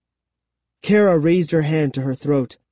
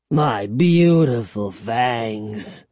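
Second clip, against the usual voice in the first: slow, low vampire wail; vampire's lament; 4 semitones lower, much slower than usual. A narrator's reading. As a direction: mourn it in a drawn-out voice. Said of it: "he cried".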